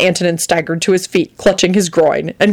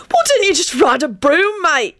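high, teasing shout of glee; gleeful call; 10 semitones higher than usual. Acted. Sirius ribs Antonin about a broom, gleeful. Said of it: "Sirius called out gleefully".

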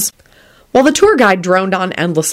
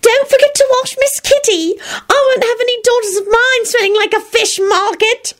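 very high, imitated calling; mother yelling upstairs. Solange imitating Mother at bath time, high yell. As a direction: mimic a scolding call up the stairs. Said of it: "she'd yell up the stairs".

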